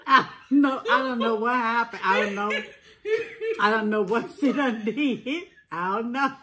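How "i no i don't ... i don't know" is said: laughing through the words. Real, not acted. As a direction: giggle while denying it. Caught smacking, laughing it off.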